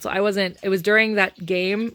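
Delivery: ordinary talk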